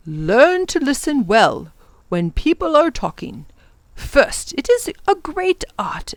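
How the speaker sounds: swooping pitch, emphatic points; lecturing professor. Acted. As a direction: teach a young man a lesson. Professor lectures young Tom.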